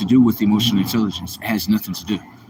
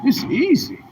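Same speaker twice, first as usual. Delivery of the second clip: high, playful; 10 semitones higher than usual. Shown as a demonstration. Repeating "it's easy" again.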